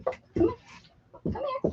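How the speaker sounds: sing-song call to a cat, way up high; high pet-calling voice. Real, not acted. Owner calling her cat in a high coaxing voice. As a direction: coax the cat over sweetly.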